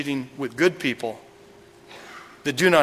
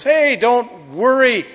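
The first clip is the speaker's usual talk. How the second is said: slow, high-pitched "no worries"; retold casual reassurance; breezy reassurance; 7 semitones higher, 4 dB louder, slower than usual. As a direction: drag it out high, brushing the worry aside. Queen acting breezy to the king.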